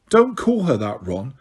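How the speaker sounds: ordinary delivery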